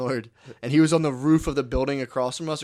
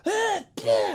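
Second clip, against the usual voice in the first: high-pitched full-force mock sneeze; forced sneeze. Shown as a demonstration. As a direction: force out a big imitation sneeze. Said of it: "just force it out as hard as I can".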